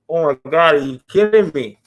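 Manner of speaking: mildly exclaimed disbelief; disbelieving reaction. Retold or imitated. Host answering the guest's modesty about drawing, mock disbelief. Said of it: "And I go".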